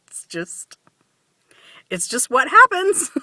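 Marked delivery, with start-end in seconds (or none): 0.1-0.8 whisper. Real, not acted. Whispered aside.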